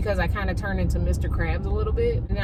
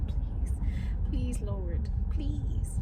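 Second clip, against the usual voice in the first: soft pleading whisper; whispered prayer; 3 semitones higher, 9 dB quieter, much slower than usual. Real, not acted. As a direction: beg quietly under your breath. Praying for delivery.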